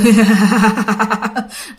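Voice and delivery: a possessed woman's creepy chuckle; eerie laugh. Retold or imitated. Possessed grandma laughs instead of answering, creepy chuckle. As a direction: laugh like a spirit mocking them. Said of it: "Chỉ cười mà cười".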